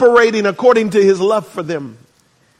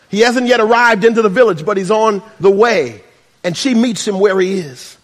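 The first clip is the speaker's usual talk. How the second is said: ordinary talk, nothing marked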